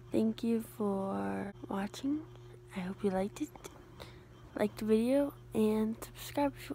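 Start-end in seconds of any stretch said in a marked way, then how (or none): 0.1-3.5 whisper. Real, not acted. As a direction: whisper it so no one wakes. Bedtime sign-off, whispered.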